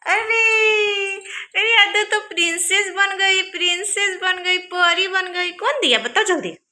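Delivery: 3 coo over her like she's a little princess; 4 mother cooing over her daughter's new dress, high and delighted. Real, not acted.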